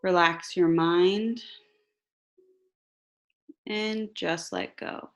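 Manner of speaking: slow, calm meditation voice; guided meditation. Acted. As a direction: guide them gently into relaxation.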